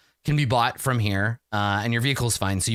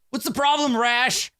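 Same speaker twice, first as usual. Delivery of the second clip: much higher, calling from the back; curious coworker; 11 semitones higher than usual. Acted. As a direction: ask the other brother what's wrong. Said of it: "one of the other ones is like".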